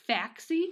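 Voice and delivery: Faxi? said as FAX-ee, like the word fax; wrong pronunciation. Retold or imitated. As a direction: read Faxi? as it is spelled, a hard fax sound. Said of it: "I would have just been like".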